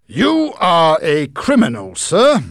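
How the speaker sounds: high, loud stern accusation; accusing. Acted. Micawber denouncing Heep, loud and high. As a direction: denounce him to his face.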